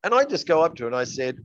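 normal talking voice

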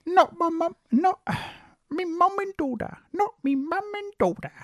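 very high, hammed-up British accent; bad British accent. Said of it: "really bad British accent".

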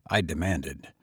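ordinary delivery

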